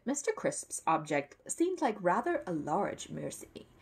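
ordinary delivery

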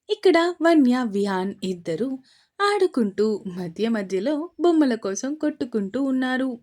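ordinary delivery, nothing marked

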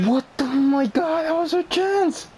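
high, frantic disbelief; shocked yell. Real, not acted. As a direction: yelp it out, stunned. Gamer reacts to missed chance.

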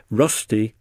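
ordinary delivery

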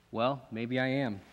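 ordinary talk

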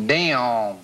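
"Damn" stretched into a Southern drawl; Southern accent. Shown as a demonstration. Host imitating what Southerners say, drawn-out Southern accent. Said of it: "they like to say this".

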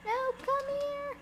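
very high squeaky plea; pleading call. Real, not acted. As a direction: squeal for it to come back. Calling after the car.

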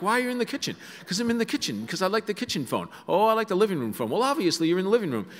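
put-on high, loud squabbling old couple; high fussy parents quarrelling about rooms; mimicking bickering parents. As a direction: play both parents nagging over the phone.

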